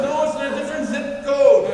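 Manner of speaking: high, loud, laughing put-down; mock the caller while chuckling; laughing mockery. Ticket seller mocking the dad, loud and laughing.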